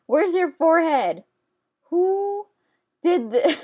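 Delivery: comic indignant shout at the character; mock exasperated. Real, not acted. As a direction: scold the drawing like a silly pet. Mock scolding her drawing.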